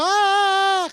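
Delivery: high, loud demon yell; hammed-up scream of the charging devil; mock demon scream. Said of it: "vino gritando".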